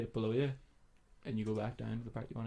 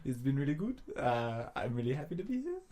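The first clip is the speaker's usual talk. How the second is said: imitated South African accent; 3 semitones higher than usual. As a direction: lightly mimic a South African YouTuber. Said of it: "Right here, I got an accent".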